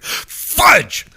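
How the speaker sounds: high, loud comic outburst; screamed fudge. Acted. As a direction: scream it like a golfer's substitute curse. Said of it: "Sorry for screaming in your ear".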